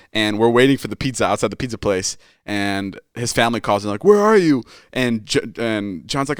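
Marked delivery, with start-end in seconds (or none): 4.0-4.6 mock angry call. Family calling late John.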